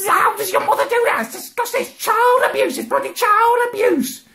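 high-pitched furious rant; outraged rant. Real, not acted. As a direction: rant in disbelief. Ranting in outrage.

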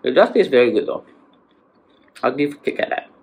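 talking around chocolate; chewing while talking. Real, not acted. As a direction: mouth partly full. Seen 2: Bites bar just before; cheeks and lips move, bar held at mouth.

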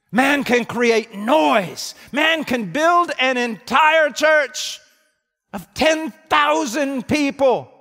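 loud, slow, high pulpit proclamation; slow preaching shout. Acted. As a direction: hammer each word out slowly. Preaching at full force.

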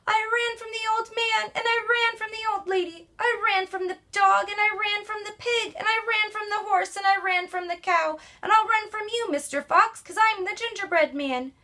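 high, boastful gingerbread man taunting; high cheeky runaway cookie; cocky gingerbread man. Acted. Gingerbread man voiced in story.

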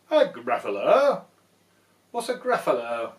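hammed-up fright; startled owl. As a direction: blurt it in surprise.

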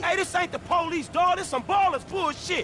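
high, urgent angry call; angry alarm. Acted. Realizing the meet is a Ballas trap, high angry alarm. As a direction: warn the crew, furious. Ambush, realizes they were set up.

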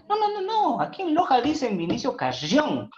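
mock-insist, laughing at him; high playful imitation of a Valencian woman correcting him; colleague's playful objection.

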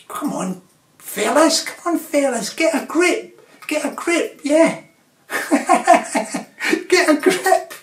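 high, loud rallying call, building; rousing the fellas. Acted. Vlogger rallying the fellas, loud and high. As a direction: chivvy the lads on.

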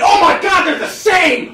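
loud, high delighted blurt; excited outburst. Retold or imitated. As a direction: blurt it out in happy shock.

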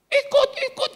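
put-on high-pitched childish pleading; put-on little kid's clamour; begging child. Children begging to join father's ministry trip, high pleading.

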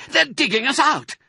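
very high, urgent cry; alarmed shout. A narrator's reading. Mister Fox warning his wife of diggers, alarmed shout. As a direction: shout the danger out in panic. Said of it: "he shouted".

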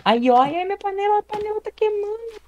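very high pitched panic over the pan; panicked squeal. Real, not acted. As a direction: squeak in sudden alarm. Panics about burning pan.

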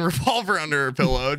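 ordinary delivery

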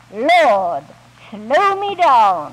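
Lord, slow me down said slowly, each word weighted; slow, weighty reading. Shown as a demonstration. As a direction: read the sign out slowly and with weight. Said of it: "in great big letters".

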